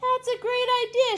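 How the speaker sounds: very high loud squeal; loud high excited shriek. Acted. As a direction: shriek with delight. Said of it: "shrieked Nebraska".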